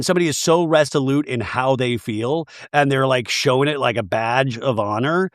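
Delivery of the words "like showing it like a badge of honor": ordinary delivery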